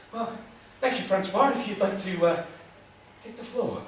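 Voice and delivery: usual speaking voice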